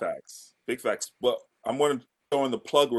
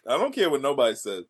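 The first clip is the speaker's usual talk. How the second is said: usual speaking voice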